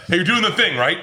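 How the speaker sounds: pushy prodding. Retold or imitated. Wait staff prodding the comic about the raffle, pushy. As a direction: imitate someone nudging him along. Staff pushing him to act.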